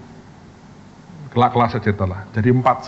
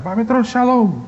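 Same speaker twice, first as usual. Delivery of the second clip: friendly greeting; 11 semitones higher than usual. Retold or imitated. Acquaintance greets him in toilet.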